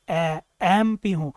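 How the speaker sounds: एमपी said slowly, opening on a clear e; slow sounding out. Shown as a demonstration. Teacher showing the first vowel sound. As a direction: hold the ए before एमपी. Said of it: "ए की आवाज़ से चालू होता है".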